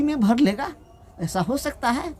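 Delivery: high disbelieving question; incredulous pandit. Acted. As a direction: voice jumps up in disbelief. Pandit doubts the merchant's story. (पंडित जी का ऊँचा, अविश्वास भरा सवाल)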